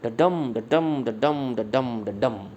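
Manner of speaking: slow da-DUM beat, stress on every second syllable of डडम; rhythm demo. Shown as a demonstration. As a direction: chant डडम five times, weak then strong. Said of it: "तो rhythm कैसी बनेगी".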